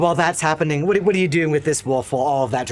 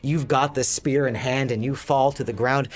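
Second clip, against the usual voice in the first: usual speaking voice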